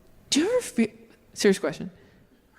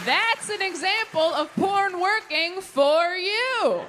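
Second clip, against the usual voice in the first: slow, higher deadpan payoff; slow punchline; 9 semitones higher, slower than usual. Real, not acted. Comic landing a joke for the crowd, slow and higher. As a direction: drag out the punchline.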